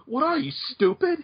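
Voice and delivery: mock Oscar voice. Friend imagines her snapping back at the fan in Oscar's voice. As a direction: lightly toss off the taunt as Oscar would. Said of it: "in perfect Oscar voice just go".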